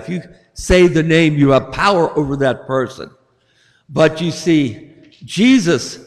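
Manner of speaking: normal talking voice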